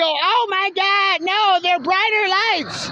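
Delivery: high, drawn-out mock horror; put-on high, slow whine of dismay. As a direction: wail it slowly in pretend alarm. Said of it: "I'm gonna go".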